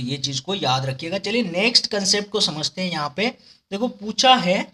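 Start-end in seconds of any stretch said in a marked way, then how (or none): none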